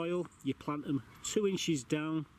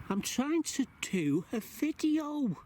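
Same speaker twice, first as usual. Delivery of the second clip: high, slow, pleading whine; exasperated whine; 11 semitones higher, slower than usual. Real, not acted. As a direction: whine at the cat in despair. Cat interrupting.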